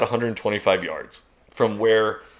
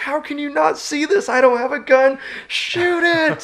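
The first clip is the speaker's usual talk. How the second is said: very high, frantic shouting; retold exasperated yell; 5 dB louder than usual. Unarmed hunter to buddies who can't see bear, exasperated yell. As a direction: yell in disbelief, building louder to the final order. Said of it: "I'm yelling".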